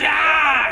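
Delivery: high shouted ah of effort; hammed-up yell under the lightning; mock battle scream.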